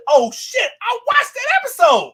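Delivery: loud, high retold exclamation; shocked recognition. Viewer suddenly recognising an old episode, shocked shout.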